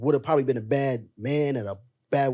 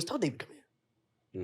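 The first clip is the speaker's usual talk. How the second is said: grandma's quiet order; 2 semitones higher, 11 dB quieter, slower than usual. Retold or imitated. Grandmother sends a boy.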